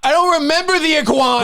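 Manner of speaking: very high, loud falsetto retort; squeaky mock reply. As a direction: answer in a silly squeal.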